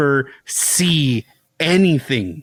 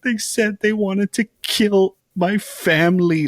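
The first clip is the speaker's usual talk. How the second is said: imitating crying; 7 semitones higher than usual. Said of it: "she's still crying".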